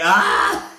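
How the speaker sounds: short loud cry of disgust; mock grossed-out scream. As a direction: yell out as if grossed out. Reacts to gross gobbling noise.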